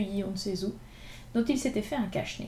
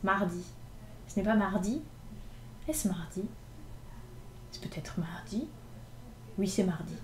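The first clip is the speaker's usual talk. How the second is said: grumbled under his breath; 2 semitones lower, 7 dB quieter than usual. Acted. Street boy muttering to himself about the day, grumbling softly. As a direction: mumble it into your scarf. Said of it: "Il grommelait entre ses dents".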